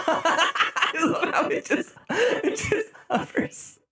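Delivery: high, slow words through laughter; laughing hard. Real, not acted. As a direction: barely get it out for laughing.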